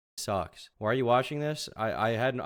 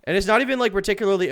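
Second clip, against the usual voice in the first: loud rant; 9 semitones higher, 11 dB louder, faster than usual. Real, not acted. Rant continues.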